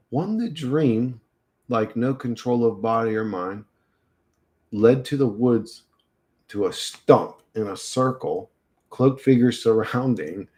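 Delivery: deliberate phrases with pauses between. Real, not acted. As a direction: read it out piece by piece, puzzling it over. Rereads puzzling message slowly, piece by piece.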